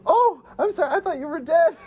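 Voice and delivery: very high mock-startled voice; squeaky quoted apology. Acts character's startled apology.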